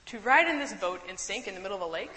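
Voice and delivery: usual speaking voice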